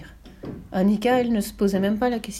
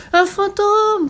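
much higher, louder panicked shout; frightened shout; 12 semitones higher, 8 dB louder, much slower than usual. A narrator's reading. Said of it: "cria Tommy".

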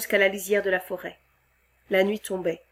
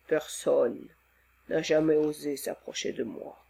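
calm him like a kind giant; gentle monster voice; 5 semitones lower, 4 dB quieter than usual. Acted. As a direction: monster soothing the frightened man. Monster explaining.

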